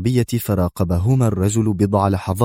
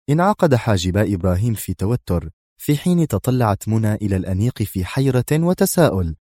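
plain reading voice

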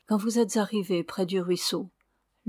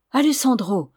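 raised, louder proud exclamation; 4 semitones higher, 6 dB louder than usual. A narrator's reading. Ramona answering the signora's threat, proudly. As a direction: declare the name with pride. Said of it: "reprit fièrement la jeune fille".

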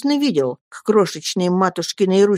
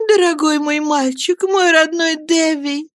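tender exclamation; 10 semitones higher than usual. A narrator's reading. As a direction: call the boy lovingly. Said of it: "воскликнула матушка".

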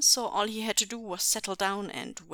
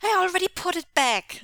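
high, loud protest; insistent child; 11 semitones higher, 9 dB louder than usual. A narrator's reading. Son protests to father about the mess, insistent. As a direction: insist like a small boy, voice pitched up. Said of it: "said Jingyi insistently".

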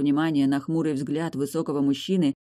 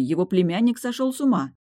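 usual speaking voice

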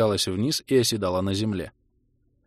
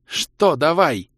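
slow, high, loud question; stretched-out, agitated query; exhaled bewildered question; 7 semitones higher, 6 dB louder, much slower than usual. A narrator's reading. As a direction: draw it out, thrown off. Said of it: "выдохнула окровавленная фигура".

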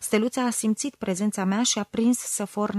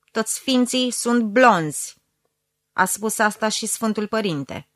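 much louder, scandalized tirade; berating shout; 8 dB louder than usual. A narrator's reading. Mother berating daughter as an unbeliever, loud outraged tirade. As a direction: blast her with outraged piety.

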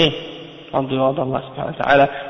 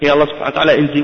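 ordinary delivery

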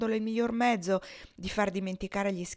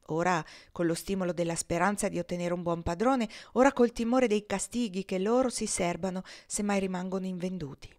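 plain reading voice

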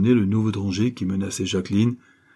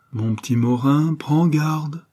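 chilling calm; 6 semitones higher, slower than usual. Acted. Diana warning her lover, frighteningly calm. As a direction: warn him coolly, menace under calm. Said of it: "sur un ton de calme effrayant".

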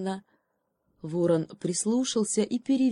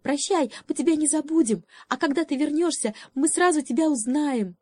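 high, squeaky little squirrels; 9 semitones higher than usual. Acted. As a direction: chatter goodbye like tiny squirrels. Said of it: "запищали белочки". (высокий писк белочек)

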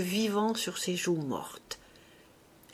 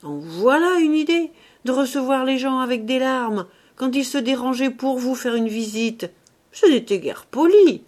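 put-on louder, higher scolding of the old mother; scolding grumble; 5 semitones higher, 6 dB louder than usual. A narrator's reading. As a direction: tell her off loudly for crying. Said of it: "gronda la vieille mère".

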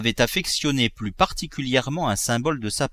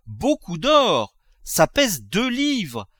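gloating; 8 semitones higher, slower than usual. Acted. Miser weighing the gold, gloating high.

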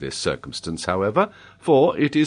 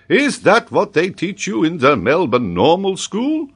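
loud, high incredulous question; appalled professor; 11 semitones higher, 5 dB louder than usual. A narrator's reading. Geographer asks what the school teaches, appalled and loud. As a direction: question the pupil in disbelief.

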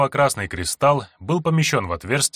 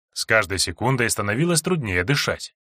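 ordinary delivery, nothing marked

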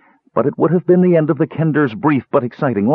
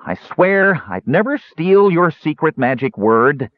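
higher, slowed earnest oath; frantic pleading; 6 semitones higher, slower than usual. A narrator's reading. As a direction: plead to be believed. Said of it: "he cried frantically".

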